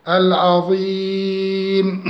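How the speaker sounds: slow chanted العظيم with a long ee; lengthened madd. Shown as a demonstration. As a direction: hold the ee of العظيم well before closing on m. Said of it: "أو أربعة أو ستة".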